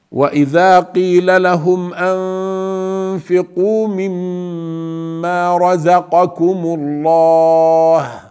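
melodic tajweed chant; Quranic recitation. Shown as a demonstration. Teacher reciting the verse to students, melodic chant. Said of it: "teacher reciting verse".

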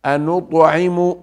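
melodic tajweed chant; Quranic recitation. Shown as a demonstration. Teacher reciting the verse to students, melodic chant. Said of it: "teacher reciting verse".

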